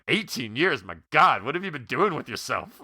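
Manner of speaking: be appalled at the number; mock shock. Host imitates the dad shocked at Pat, mock incredulous. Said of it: "he looks at him, he's like".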